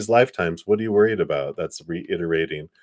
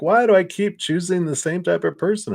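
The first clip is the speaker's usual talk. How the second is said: mock exasperation, pitched much higher and louder; exasperated self-quote; 12 semitones higher, 5 dB louder than usual. Retelling her frustration over five relationships, exasperated and high. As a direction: throw the question up in disbelief, higher.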